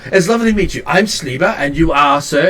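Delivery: high, polite little tinkerer; high gnome voice. Acted. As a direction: greet them high and chirpy. Gnome introduces himself.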